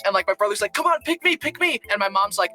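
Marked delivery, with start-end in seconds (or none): 0.8-1.8 high-pitched mock pleading; imitated begging. Brother lobbying in a board game, mock begging.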